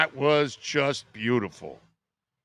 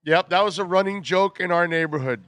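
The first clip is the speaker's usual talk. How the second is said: ordinary talk, nothing marked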